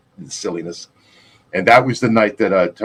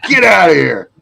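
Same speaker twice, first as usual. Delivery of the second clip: a sister's loud mock yell, high and loud; retold yell; 7 semitones higher, 7 dB louder than usual. Sister yelling at her little brother to get out, mock shout. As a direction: shoo the kid away with a hammed-up shout.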